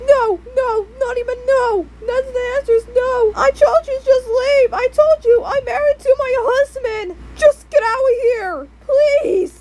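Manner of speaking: very high, wailing mom voice; hammed-up desperate rejection; upset mom. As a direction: refuse him in a high, tearful squeal. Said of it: "And my mom says".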